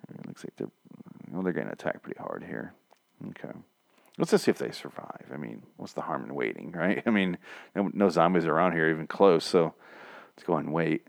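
low, hushed aside; quiet, casual conferring among lurkers; furtive lookout. Acted. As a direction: murmur it while hiding and watching. Highwaymen lurking, watching from afar.

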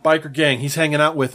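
usual speaking voice

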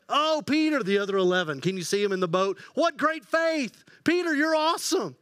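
high, eager praise from the boat; admiring cheer. Acted. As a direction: gush over Peter like a fan. Disciples admiring Peter in boat.

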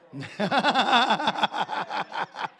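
long, loud rapid-fire laugh; rattling belly laugh; loud cackle. Real, not acted. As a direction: cackle heartily at your own joke. Laughs at own joke.

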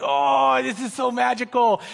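lightly hammed-up awe; mock gushing. Mocks campers gushing at altar call.

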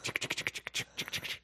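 quiet, unvoiced rapid clicks; mimicked clatter of a scriptwriter typing; imitated typewriter clicking. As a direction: tick it out softly with no voice. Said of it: "sitting down with the cigarette in their mouth".

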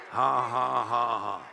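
slow, deliberate ha-ha; demonstrated laughing. Shown as a demonstration. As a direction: show them how to laugh on purpose. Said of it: "might need to practice that".

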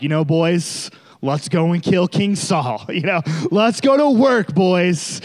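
loud, high mock rally cry with a laugh; cocky rallying. David rallies his men to kill Saul, cocky and loud. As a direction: gee the boys up like a swaggering leader.

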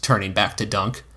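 usual speaking voice

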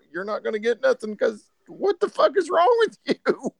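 high, incredulous scolding of himself; exasperated self-talk. Retold or imitated. Hunter scolding himself mid-hunt, exasperated. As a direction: squeal it in mock frustration.

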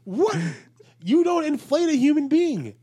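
high, loud disbelieving cry; incredulous outburst. Real, not acted. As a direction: shout your disbelief. Incredulous at absurd claim.

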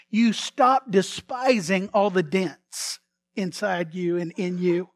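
ordinary delivery

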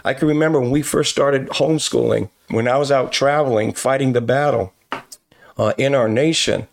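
normal talking voice

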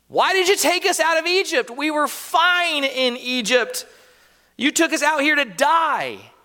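ungrateful people moaning at Moses; whiny Israelite complaint. Acted. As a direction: whine it like a tired, complaining crowd. Israel's complaint voiced in sermon.

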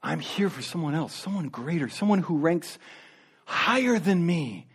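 bow low and speak it gently; a quiet, reverent confession of unworthiness; humble John. Retold or imitated. John humbly points to greater one.

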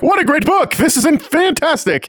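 high, hearty, oblivious praise; enthusiastic All Might. Retold or imitated. All Might takes the coded book at face value, delighted. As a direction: rave cluelessly in a hammed-up hero voice.

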